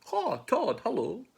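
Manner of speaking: high-pitched calling out; cheery mouse greeting. Acted. Mouse greeting Todd, high sing-out call. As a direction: hail a pal in a high voice.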